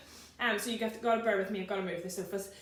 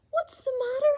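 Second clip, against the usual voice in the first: much higher, sing-song concerned giant; kind giant voice; 14 semitones higher, 6 dB louder than usual. Acted. George asking the sad giraffe, high and caring.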